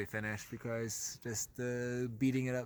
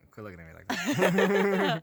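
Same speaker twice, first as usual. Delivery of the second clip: laughing protest; 5 semitones higher, 9 dB louder, slower than usual. Real, not acted. Teasing partner.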